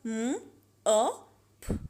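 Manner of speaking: म, अ, प as separate "m", short "o", "p"; sounded out. Shown as a demonstration. Teacher spelling out mop by sound, म, अ, प each isolated. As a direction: break mop into म, अ, प with long gaps. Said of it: "short sound में example".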